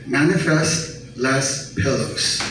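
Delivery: normal talking voice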